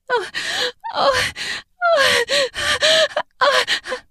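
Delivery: high repeated moans; insistent moans. Acted. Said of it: "Her moans are growing insistent".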